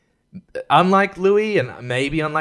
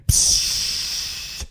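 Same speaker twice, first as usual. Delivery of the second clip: long unvoiced "psst" of escaping gas; imitated gas hiss; 3 dB quieter than usual. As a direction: hiss like gas being let out. Imitating gas released into the room.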